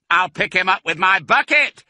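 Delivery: high, loud boast; full-voiced, high-pitched holler; shouted. A narrator's reading. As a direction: yell it from the digger. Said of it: "shouted Bunce".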